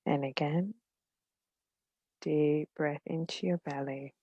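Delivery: gentle meditation guide, long pauses between phrases; unhurried, soothing guidance, letting each phrase settle; guided meditation. Real, not acted.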